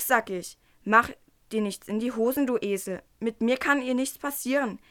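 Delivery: usual speaking voice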